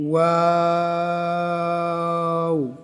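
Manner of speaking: long chanted ওয়াও; drawn-out waw. Shown as a demonstration. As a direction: stretch ওয়াও three alif lengths. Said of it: "তিন আলিফ টান হবে".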